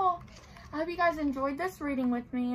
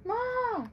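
a high bleating goat call; 11 semitones higher than usual. Acted. Goat asks to come in, high bleat. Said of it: "said the goat".

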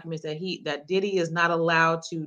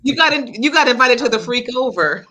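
higher and louder, gleeful teasing; excited teasing; 4 semitones higher, 7 dB louder than usual. Real, not acted. Teasing a friend who admits an invitation, excited and loud. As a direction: pounce on the slip with delight.

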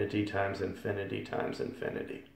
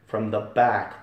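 normal talking voice

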